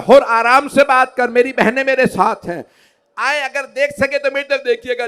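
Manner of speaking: in my own style. Shown as a demonstration. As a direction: lift it into a loud preaching cry. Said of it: "मैं अपने अंदाज से पेश कर रहा". (अपने अंदाज़ में ऊँची आवाज़ से)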